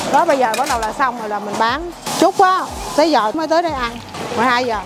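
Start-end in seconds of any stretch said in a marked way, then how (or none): none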